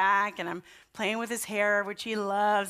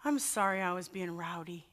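soft little confession at bedtime; quiet apology, retold; 3 semitones lower, 7 dB quieter than usual. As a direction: imitate a small son softly owning up. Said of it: "it's quiet for a while".

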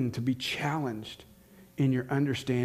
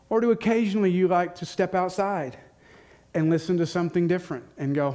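raised, louder preaching build; preaching at full force; 5 semitones higher, 5 dB louder than usual. Acted. Preacher challenging congregation on echo chambers, full force.

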